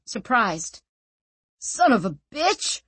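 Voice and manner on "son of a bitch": higher, startled outcry; crying out. Acted. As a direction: cry out in shock. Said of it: "he cried".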